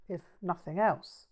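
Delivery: usual speaking voice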